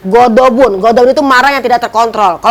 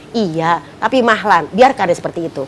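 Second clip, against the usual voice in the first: gentle calming; 4 semitones lower, 5 dB quieter than usual. Acted. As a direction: soothe her, lowering the voice and easing the pace. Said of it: "Diam, pelan-pelan".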